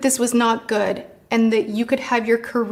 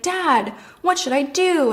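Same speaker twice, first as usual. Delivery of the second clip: mock appeal to dad, pitched higher; imitated plea for help; 5 semitones higher than usual. James asking dad for help, mock pleading. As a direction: imitate someone turning to dad for advice.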